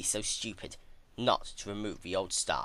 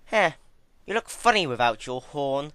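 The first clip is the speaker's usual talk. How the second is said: higher, louder sneering taunt; mocking villainess; 8 semitones higher, 9 dB louder than usual. Twilight mocking hornless Celestia, higher sneering taunt. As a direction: scoff at her like a cruel young witch.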